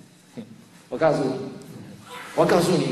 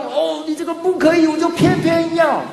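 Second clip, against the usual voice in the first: defiant mock reply; 9 semitones higher than usual. Voicing defiant sinful desire.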